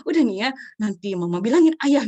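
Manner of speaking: loud, mildly scolding mom; mock parental threat. Mother threatening crying child, mock scolding. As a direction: imitate a mother warning she will tell. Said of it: "Wah, ancaman".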